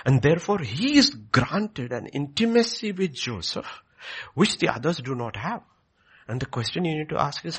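loud, high full-force sermon delivery; fervent preaching. Acted. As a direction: preach it out to the back pews. Preaching to congregation.

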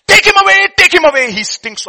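very high, loud shout; grieving husband shouting at the family, high and loud; anguished cry. Acted. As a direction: cry out in horror to push the baby away. Guilty man crying out.

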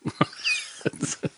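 boop sound effect. Pretend.

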